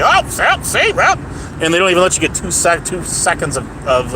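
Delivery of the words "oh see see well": much higher, smug voice that cuts you off; a know-it-all breaking in over you; mocking the blowhard. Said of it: "They blast over you and say".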